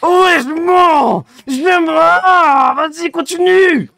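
high, loud mock rapture; over-the-top high cries of pleasure; hammed-up ecstasy. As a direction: ham up the thrill of being insulted. Mock ecstatic reaction to insults.

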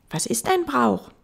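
put-on high questioning child voice; little prince. Little prince asking the fox a question, high child voice. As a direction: ask like a curious small boy.

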